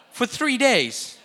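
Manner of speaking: laughing disbelief. Real, not acted. Mocking Mary and Joseph's negligence.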